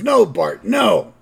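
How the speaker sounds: loud, high dad's veto; exasperated refusal. Retold or imitated. Homer refuses Bart's museum idea, loud mock exasperation. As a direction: shut it down loudly, mock-exasperated.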